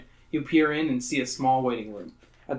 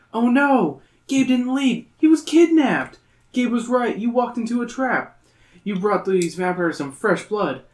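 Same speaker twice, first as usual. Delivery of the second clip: higher, louder dramatic alarm; alarmed narration; 8 semitones higher, 4 dB louder than usual. A narrator's reading. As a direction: build the shock.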